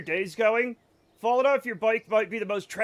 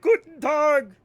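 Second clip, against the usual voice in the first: high, loud hammed-up German composer; mock Brahms bellowing Guten Tag; booming Brahms greeting; 8 semitones higher, 9 dB louder, slower than usual. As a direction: burst through the wall with a grand German hello. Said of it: "and he's like".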